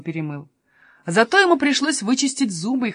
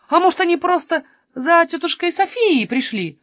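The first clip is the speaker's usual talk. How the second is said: high, louder, hopeful question; 9 semitones higher, 5 dB louder than usual. Acted. Jonatan guessing they only came for Sofia, high and hopeful. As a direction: lift the voice with eager hope. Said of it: "с надеждой сказал Юнатан".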